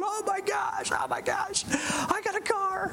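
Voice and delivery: high shrieking joy, a whispered gasp in the middle; freaking out. Retold or imitated. Studio audience member winning a car, high shrieking joy. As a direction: squeal like you just won a car. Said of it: "everybody in the studio audience was freaking out".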